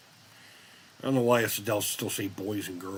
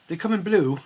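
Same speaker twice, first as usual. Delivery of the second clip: higher, prim salesman voice; shoe salesman impression; 8 semitones higher, 4 dB louder than usual. Spinal Tap guitarist playing a shoe clerk, prim higher voice. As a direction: play the guitarist faking a shop clerk. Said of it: "pretending to be a shoe salesperson".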